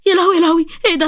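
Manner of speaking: put-on high, panicked girl's cry; frightened cry. Sara crying out at the skeleton that appears, high and panicked. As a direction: squeal in terror at the skeleton. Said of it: "سارة فضلت تصوت".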